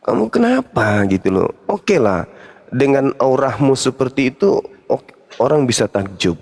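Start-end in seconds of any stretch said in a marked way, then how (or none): 0.0-1.0 high-pitched mock telling-off; higher-pitched, mildly cross question; retold scolding. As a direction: imitate yourself scolding, without raising volume. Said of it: "pernah saya marah juga sama dia".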